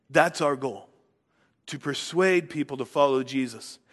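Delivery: ordinary delivery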